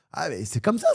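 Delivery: ordinary talk, nothing marked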